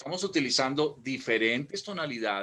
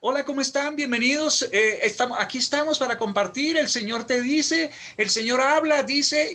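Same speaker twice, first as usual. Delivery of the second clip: far higher and louder the whole way; mock high-pitched speaker; 13 semitones higher, 9 dB louder than usual. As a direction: parody a preacher stuck up high. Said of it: "gente que habla así siempre".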